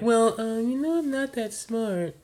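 put-on a small kid admitting it, drawn out; voiced young son. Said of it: "when he's like".

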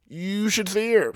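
ordinary talk

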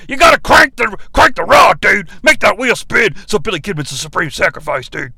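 loud, fast wrestler rant with a put-on rasp. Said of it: "in the [name] voice".

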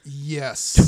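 loud triumphant cheer; excited yes. Real, not acted. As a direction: shout it out in triumph. Cheering the zombie plan.

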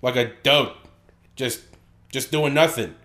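brash mocking Bostonian; Boston accent. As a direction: heckle in a broad Boston accent. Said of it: "do it with the Boston accent".

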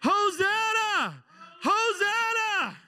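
slow, high, loud hosanna; long shouted hosanna; drawn-out praise shout. Pretend. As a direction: cry it out full-throated to heaven. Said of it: "You know what Hosanna means".

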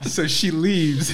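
slow, laughing narration. Real, not acted. Telling friends how she walked off, laughing. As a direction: chuckle out each word.

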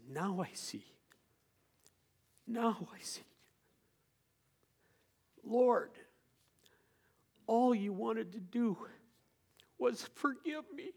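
half-whispered wonder, gentle and awed. Retold or imitated. Forgiven dying man speaking gently to the Lord. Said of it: "suddenly he speaks gently".

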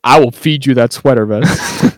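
slow, loud mock threat; retold aggression. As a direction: imitate a threat, leaning on each word. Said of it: "very outwardly, like, aggressive to Philip".

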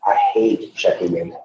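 normal talking voice

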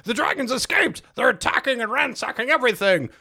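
high, frantic alarm from a fleeing orc guard; mock-frantic high guard voice; panicked guard. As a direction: blurt it out in a high-pitched panic. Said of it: "He goes".

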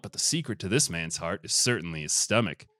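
ordinary delivery

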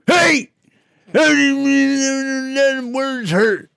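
high, slow hillbilly whine. Acted. Hick voice, high pitched slow.

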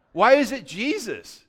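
usual speaking voice

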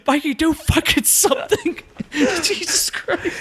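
high, laughing through the words; laughing cornerman. Real, not acted. Cornerman pleading with Mikey, laughing hard. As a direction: plead with the fighter while cracking up. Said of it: "imitating a panicked cornerman".

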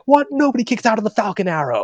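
high-pitched, incredulous commentator outburst; mock being outraged on commentary; imitated outrage. Fan mimics commentator after a Falcon Arrow kickout, outraged and high. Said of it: "he gets really outraged".

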